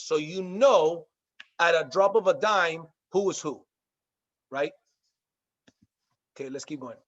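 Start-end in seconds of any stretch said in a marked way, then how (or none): none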